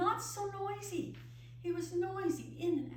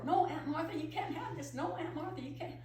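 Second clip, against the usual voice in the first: put-on higher, whiny child's protest; stubborn little boy; 6 semitones higher than usual. Boy refusing to give Aunt Martha the kettle, whiny. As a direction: play the boy clinging to the kettle.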